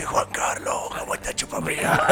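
rough unvoiced croak of a put-on voice; strained, voiceless character impression; imitating a creature's voice. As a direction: croak it out like a mouth opening to speak. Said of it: "que abre la boca y dice".

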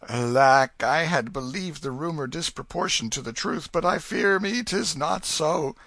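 high-pitched, loud lament; deep disappointment. A narrator's reading. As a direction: lament like a let-down king. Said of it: "in a tone of deep disappointment".

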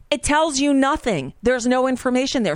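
ordinary delivery

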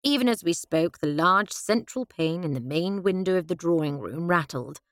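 ordinary delivery

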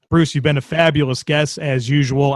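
ordinary delivery

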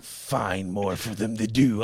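low, slow imitation of a stern boss; mock demanding boss. Employee retells boss's deep order, low and slow. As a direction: drag it out like a dead-calm boss.

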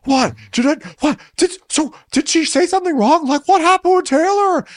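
high, rising mock confusion; mocking Shep. Shep confused about Taylor, high baffled mock voice. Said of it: "Shep quoted reacting".

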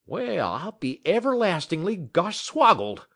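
high, loud folksy astonishment; astonished. Acted. Dog musher astonished at the clever dog, high and loud. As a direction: blurt it out amazed. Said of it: "the dog musher's expression of astonishment".

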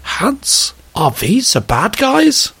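put-on high, slow comic German accent; mock German accent, dawning worry. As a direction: ask it as a troubled German officer, hammed-up accent. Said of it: "this is my brilliant German accent".

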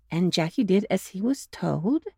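plain reading voice

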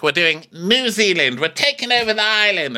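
teasing voice of the film crew; mock film crew boast. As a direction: brag like overeager filmmakers. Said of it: "They're like".

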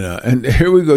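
normal talking voice

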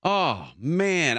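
ordinary talk

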